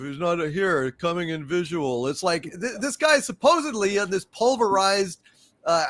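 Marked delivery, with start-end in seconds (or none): 0.0-2.1 deadpan imitation of a bad actor; reading cue cards. Host mocking the actor who played Hanson, wooden cue-card reading. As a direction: recite it like lines off a card. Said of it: "he's fucking reading cue cards or something".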